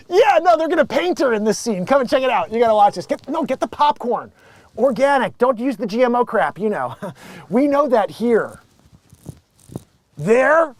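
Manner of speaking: high, loud excited alien spectator; alien viewer. Pretend. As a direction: hype up the show for your buddy. Alien viewer bit continues.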